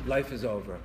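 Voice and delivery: low, downcast mumble of despair. Retold or imitated. Preacher mimicking someone walking around depressed, very low voice. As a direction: imitate a depressed person, pitch sunk down. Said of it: "you have a very low voice".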